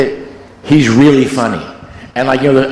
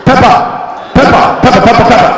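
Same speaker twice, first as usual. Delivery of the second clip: much higher, louder mock [name] chanting pepper; [name] impression; 10 semitones higher, 4 dB louder than usual. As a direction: hammer the word pepper as a giddy [name]. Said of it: "he said it again".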